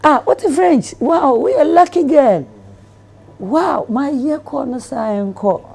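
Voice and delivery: high, loud, laughing amazement; delighted amazed man. Retold or imitated. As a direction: burst out laughing, thrilled by her French. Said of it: "He's like".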